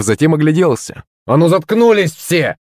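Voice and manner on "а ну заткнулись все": high barked order to shut up; yelling at the crowd. A narrator's reading. Humiliated schoolboy yells at laughing classmates, barking and high. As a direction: silence the laughing kids.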